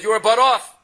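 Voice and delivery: angry shout. Retold or imitated. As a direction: hurl the accusation at the rabbi. Angry butcher accuses the rabbi.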